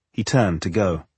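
ordinary delivery, nothing marked

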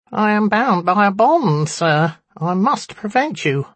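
high, squeaky little imp; squeaky nasal voice. A narrator's reading. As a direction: squeak it out through the nose. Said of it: "squeaky nasal voice".

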